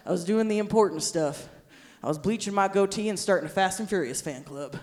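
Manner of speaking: low, soft, dopey husband impression; husband's voice. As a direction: drop low and mumble it like him. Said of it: "He was like".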